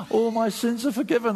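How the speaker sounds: acted overjoyed realisation; joyful amazement. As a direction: gasp as the good news hits you. Acts out new convert's joyful realisation.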